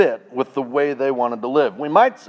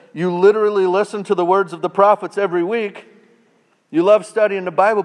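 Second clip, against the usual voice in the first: higher, louder and fast; preaching rebuke; 6 semitones higher, 6 dB louder, faster than usual. Acted. Paul rebuking the Bible readers, fast loud preaching. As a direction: drive the rebuke home.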